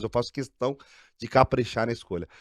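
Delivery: ordinary delivery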